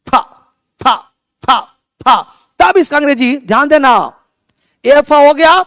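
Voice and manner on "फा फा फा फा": short separate फा syllables, airy F sound; labiodental F demonstration. Shown as a demonstration. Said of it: "अगर आप, आपका अपर teeth, ऊपर वाला दांत, नीचे वाला होठ". (फा, ऊपर के दांत नीचे के होंठ पर रखकर)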